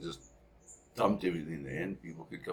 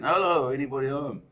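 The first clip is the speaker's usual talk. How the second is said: loud, higher call of hello into the shop; shouting again; 8 semitones higher, 7 dB louder than usual. Retold or imitated. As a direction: call out a second time, still unanswered. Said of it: "My dad shouts again".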